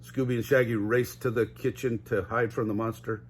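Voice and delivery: ordinary delivery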